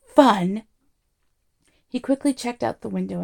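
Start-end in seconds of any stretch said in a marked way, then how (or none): 0.0-0.6 loud indignant yell at normal pitch; outraged yell. A narrator's reading. Arthur throwing Ford's word back at him, yelling. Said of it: "yelled Arthur".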